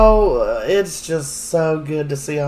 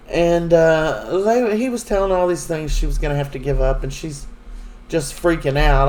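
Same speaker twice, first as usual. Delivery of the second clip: ordinary talk, nothing marked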